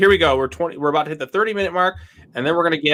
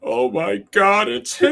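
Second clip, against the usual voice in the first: mock excitement; 5 semitones higher, slower than usual.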